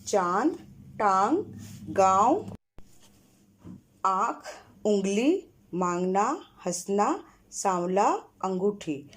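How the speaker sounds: each chandrabindu word given its own nasal vowel; word-list drill. Shown as a demonstration. Teacher reading chandrabindu words aloud to pupils. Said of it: "अब चंद्र बिंदु वाला शब्द पढ़ रहे".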